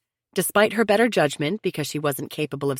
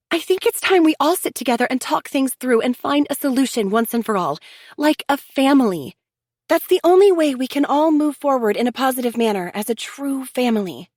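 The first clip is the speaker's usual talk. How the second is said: higher, earnest sister-in-law pleading for unity; Chelsea, insistent; 9 semitones higher, 5 dB louder than usual. A narrator's reading. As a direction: press them to sit together like family. Chelsea voiced, urging family talk.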